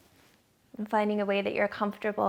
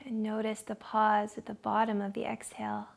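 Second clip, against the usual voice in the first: guided meditation; 6 dB quieter than usual. Acted. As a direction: ease them into calm, dropping to a whisper on the breath.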